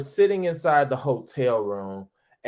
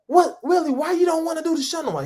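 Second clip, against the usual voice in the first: producer going off; 13 semitones higher, faster than usual. Retold or imitated. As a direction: fire it at him in a rush. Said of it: "he calls me going off".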